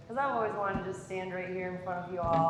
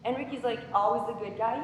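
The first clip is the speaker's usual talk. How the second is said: normal talking voice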